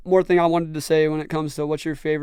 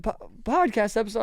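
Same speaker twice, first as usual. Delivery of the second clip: a high, puzzled echo of the words; mock incredulous friends; 6 semitones higher, 3 dB quieter than usual. Friends scoffing at his podcast excuse, mock disbelief. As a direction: play the friends who can't believe it. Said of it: "And they'd be like".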